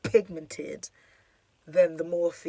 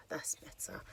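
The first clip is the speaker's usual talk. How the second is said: soft unvoiced "that's better"; a private whispered aside; whispered to herself; 12 dB quieter than usual. Real, not acted. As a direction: breathe the words out without voice. Repeats phrase to herself.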